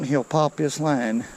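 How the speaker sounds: normal talking voice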